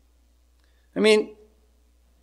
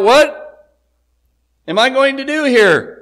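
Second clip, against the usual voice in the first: higher, louder, baffled outcry; exasperated Pilate; 7 semitones higher, 4 dB louder than usual. Retold or imitated. Preacher voicing Pilate at a loss, higher exasperated cry. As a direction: throw up your hands in frustration. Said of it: "frustrated and angry maybe".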